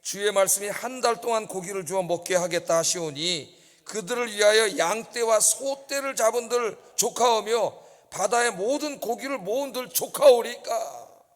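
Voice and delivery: slow, rising, emphatic Bible recitation; solemn scripture read that slows toward the end. Shown as a demonstration. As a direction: read it out like a sermon climax, dragging the last lines. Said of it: "한번 읽어보겠습니다".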